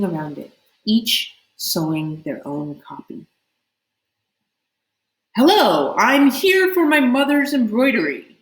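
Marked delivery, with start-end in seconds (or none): 5.4-8.4 loud, raised announcement; announcing. Acted. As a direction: announce it to the hall. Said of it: "he announced".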